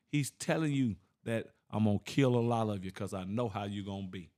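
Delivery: ordinary talk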